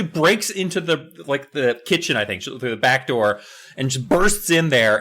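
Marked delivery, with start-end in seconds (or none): none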